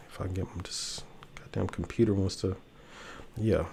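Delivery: frustrated whisper under his breath; muttered whisper. Real, not acted. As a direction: grumble it to yourself, barely voiced. Fighting his slow computer on stream.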